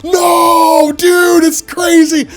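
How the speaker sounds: high, loud, slow excited shout. Real, not acted. Vlogger reacting to the scene, slow high shout. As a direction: drag it out in disbelief. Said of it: "excited reaction".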